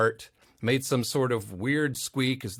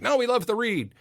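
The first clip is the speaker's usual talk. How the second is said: gushing; 10 semitones higher than usual. Retold or imitated. Producers gushing, quoted.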